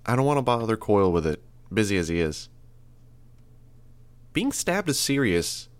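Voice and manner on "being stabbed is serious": higher, slow, a touch knowing; voiced as the sly friend on the phone; Tattletale's voice. A narrator's reading. Tattletale on the phone to Skitter, lightly voiced and slow.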